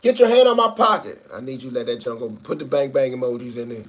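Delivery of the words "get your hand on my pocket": high, loud alarm yell; pretend shout. Streamer shouting the signal for viewers to help. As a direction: yell it out like he's being robbed. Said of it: "if I say".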